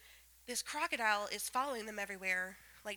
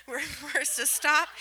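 laughing; 4 semitones higher, 8 dB louder than usual. Retold or imitated. Laughing repeat of forced stop.

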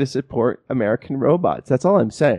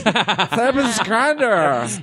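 put-on very slow, drunken "Tempest Grandin" with a high, slurred start; 10 semitones higher, much slower than usual. Shown as a demonstration. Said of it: "You get drunk and you try and say Temple Grandin".

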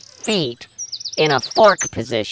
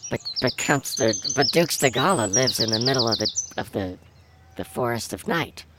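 scared whisper; 4 semitones lower, 7 dB quieter than usual. Acted. Kid scared of the dark forest.

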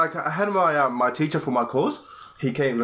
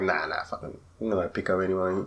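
low quick dismissal; 7 semitones lower, 5 dB quieter than usual. Retold or imitated. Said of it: "I was like".

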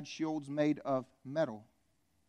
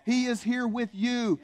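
normal talking voice

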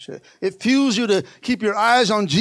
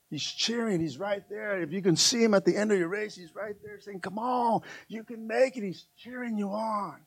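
softer, hushed encouragement; 9 dB quieter than usual. Acted. Pastor softly describing Jesus cheering the runner on. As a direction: pull it right down. Quiet after loud part.

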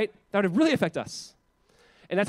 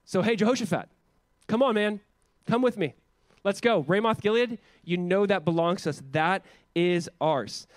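pushy persuasion; 3 semitones higher, 4 dB louder than usual. Retold or imitated. Ahab urging Jehoshaphat to take back Ramoth Gilead, pushy persuasion. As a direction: coax him insistently to join you.

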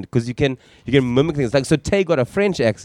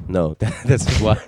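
ordinary delivery

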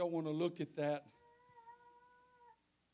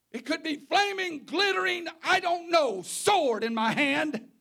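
mock biblical prophet; 7 semitones higher, 9 dB louder than usual. Voices the Lord speaking to church.

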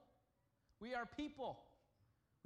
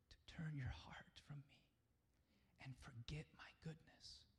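tender whisper of God; 4 semitones lower, 5 dB quieter than usual. Retold or imitated. God speaking to a complaining child, tender whisper. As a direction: whisper it like a father comforting a child. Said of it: "He's saying".

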